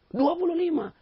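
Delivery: stubborn contrarian in a high squeal; squeaky stubborn voice. Retold or imitated. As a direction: screech the wrong number. Stubborn man in the argument.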